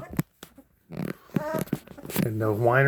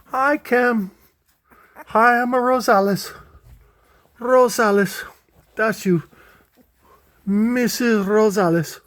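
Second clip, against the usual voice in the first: high, louder pretend puppy greeting; voicing the puppy saying hi, high and louder; 10 semitones higher, 4 dB louder than usual. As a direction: speak for the puppy, squeaky-high. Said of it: "Say hi".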